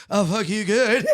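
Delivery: imitated obedient echo with laughter; laughing repetition. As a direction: parrot the line through a laugh. Repeats prompted line, laughing.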